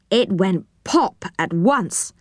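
ordinary delivery

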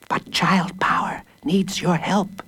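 slow, secret whisper. A narrator's reading. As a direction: hush it up through the window. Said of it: "said Shapiro".

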